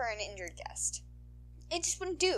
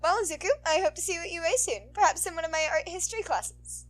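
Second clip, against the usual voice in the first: higher, louder, sweet-toned heroine voice; sultry heroine; 10 semitones higher, 9 dB louder than usual.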